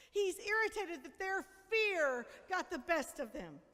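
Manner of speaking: ordinary talk, nothing marked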